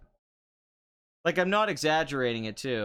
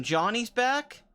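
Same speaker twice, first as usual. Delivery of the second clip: higher and slow, drawn out with glee; excited; 8 semitones higher, slower than usual. Real, not acted. Fan cheering Johnny's return in Cobra Kai, excited and drawn out. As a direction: savour the news like a hype man. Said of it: "It's awesome".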